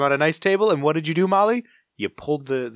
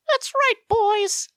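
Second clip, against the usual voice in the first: high, slow cartoon sidekick; Chippy voice; slower than usual. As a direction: squeak it like a tiny mascot.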